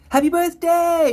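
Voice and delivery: high, loud, slow happy birthday; cheerful birthday shout. Real, not acted. Streamer wishes a viewer happy birthday, loud and bright. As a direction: sing out the wish.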